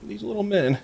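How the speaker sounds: put-on very high, tearful little-girl voice; whimpering young girl. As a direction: whimper it in a high, small child's voice. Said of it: "just screaming and crying and just is whimpering".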